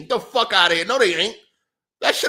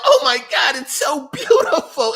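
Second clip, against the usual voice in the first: louder and higher mock gushing; hammed-up awe, raised and loud; mock awestruck gamer; 8 semitones higher, 6 dB louder than usual. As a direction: gush like a dazzled fan, to mock them.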